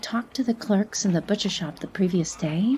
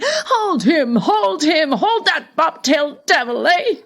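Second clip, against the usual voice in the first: grandfather yelling much louder and higher with a laugh; laughing shout; 9 semitones higher, 9 dB louder than usual. A narrator's reading. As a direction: whoop it out like an old man chasing a hare. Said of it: "unable to prevent himself from shouting".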